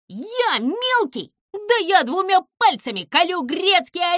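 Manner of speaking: high, loud, offended ranting; small villain's outraged bluster; indignant boasting. Acted. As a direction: puff up and threaten them at full voice. Insulted Zyza threatens everyone.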